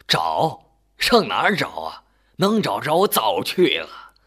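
loud, impatient retort; old man losing patience; exasperated old man. A narrator's reading. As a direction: throw it back at her, loudly.

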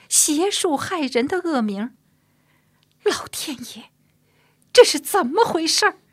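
building from hurt to a high, loud outcry; anguished protest. Acted. Woman decrying her slandered name, building to anguished outcry. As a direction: start wounded, climb to a desperate appeal to heaven.

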